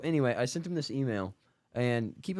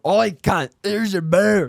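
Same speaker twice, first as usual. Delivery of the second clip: loud, high, bad Australian barman; a hammed-up Australian bartender slamming down a beer; terrible Australian accent; 10 semitones higher, 11 dB louder, slower than usual. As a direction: bark it like a rough Australian bartender, badly. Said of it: "That was a terrible accent".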